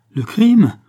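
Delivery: high, loud alarmed cry; a worker crying out in alarm at the word crime. Acted. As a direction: cry out in shock. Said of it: "s'alarma Sandro".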